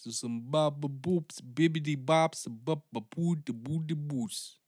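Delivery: silly scat noises. Shown as a demonstration. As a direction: scat the nonsense like a jazz singer. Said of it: "just making noises into a fucking microphone".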